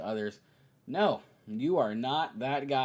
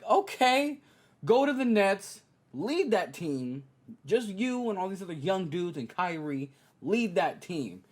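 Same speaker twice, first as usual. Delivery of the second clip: high, loud sarcastic go-ahead, slowing on lead that team; sarcastic hype, drawn out at the end; mock encouragement; 7 semitones higher, 5 dB louder than usual. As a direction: cheer him on with heavy sarcasm. Mocking reply to KD's plan.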